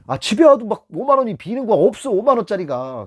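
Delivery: startled retelling; shocked realization. Retold or imitated. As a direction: recall the panic of money gone missing. Retelling shock at missing money.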